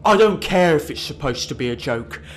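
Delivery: loud, slow, indignant put-on voice of an angry commenter; hammed-up, grumbling outrage over a TV joke; outraged Facebook commenter. Reading out an angry viewer's comment, loud mock outrage.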